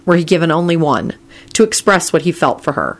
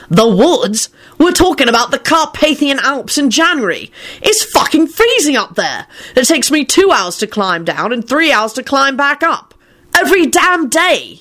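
snarling; 9 semitones higher than usual. A narrator's reading. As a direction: rant it out, snarling with disbelief. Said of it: "he snarled".

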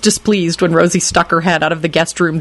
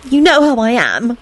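lame mumble; 7 semitones higher than usual. A narrator's reading. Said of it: "she mumbled lamely".

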